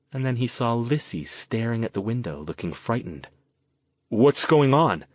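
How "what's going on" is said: louder, frustrated demand. Acted. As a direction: demand an answer, fed up. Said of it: "Jason demanded, getting frustrated".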